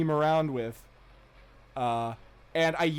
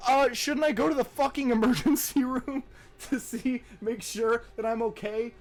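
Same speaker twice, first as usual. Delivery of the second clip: higher-pitched, put-out protest; mildly mock-incredulous plea; 8 semitones higher than usual. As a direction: plead as if stating the obvious. Retold incredulous plea to friends.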